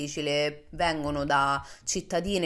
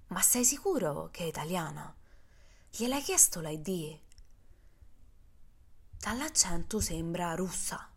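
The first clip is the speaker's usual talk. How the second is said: suspicious roommate; 4 dB quieter than usual. Retold or imitated. Roommate suspects she is Russian.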